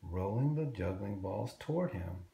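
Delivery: plain reading voice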